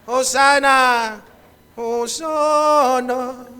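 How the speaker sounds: loud cry, then long drawn out; crowd's shout. Acted. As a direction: call out like a cheering crowd, stretching the last word. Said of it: "Anong sigaw ng mga tao?".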